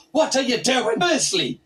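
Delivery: ordinary delivery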